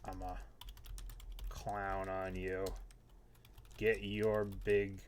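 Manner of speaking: low, slow, quiet villain taunt; menacing trash talk. Acted. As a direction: growl the threat softly. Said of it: "Just a little friendly trash talk".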